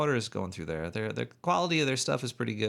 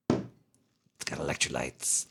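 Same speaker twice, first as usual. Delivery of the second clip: slow, lower voice; slow low movie voice; 9 semitones lower, 3 dB quieter, slower than usual. Pretend. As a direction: drag it out slowly and low. Repeats slogan differently.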